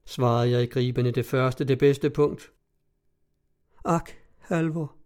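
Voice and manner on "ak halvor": frightened whisper. A narrator's reading. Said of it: "hviskede hun på ny med usigelig angst".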